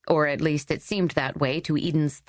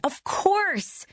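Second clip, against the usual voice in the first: much higher, delighted realisation; triumphant. Acted. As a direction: it suddenly clicks for her. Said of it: "she said triumphantly".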